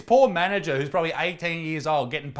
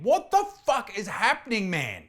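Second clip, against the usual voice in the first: high-pitched incredulous outburst; exasperated disbelief; 5 semitones higher than usual. Real, not acted. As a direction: demand it high, utterly baffled. Exasperated rant at Dave's video.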